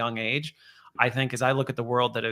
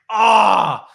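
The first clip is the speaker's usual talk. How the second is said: surprised shout; 7 semitones higher, 11 dB louder than usual. Retold or imitated. Reacting to another boy.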